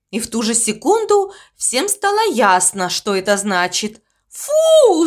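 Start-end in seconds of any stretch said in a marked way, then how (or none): 4.3-5.1 high, loud disgusted yell. Acted. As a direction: shriek it out in disgust. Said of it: "завопил Мопси". (громкий вопль отвращения)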